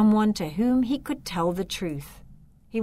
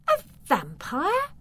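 higher, startled cry; can't believe her ears; girl hearing of a vampire, shocked; shocked disbelief; 10 semitones higher, 3 dB louder than usual. Acted. Girl hears vampire claim.

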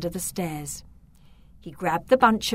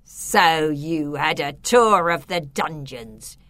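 icy voice; 4 semitones lower, 4 dB louder than usual. A narrator's reading. The Count surprises trapped Beatrice and Victor, icily. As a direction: speak with deliberate icy menace, sinking lower. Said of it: "an icy voice said".

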